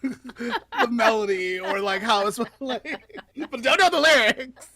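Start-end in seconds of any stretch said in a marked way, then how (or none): 3.4-4.7 laughing admission. Real, not acted. Friend admitting not knowing lyrics, laughing. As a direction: laugh through it, louder.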